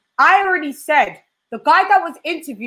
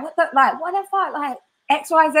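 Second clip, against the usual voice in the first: hammed-up American accent, pitched high; 5 semitones higher than usual. Retelling Ness's clip reaction in a jokey American accent. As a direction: swear it out in a mock American accent. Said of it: "You're hilarious when you do your American accent".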